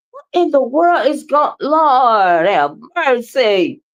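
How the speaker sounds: ordinary delivery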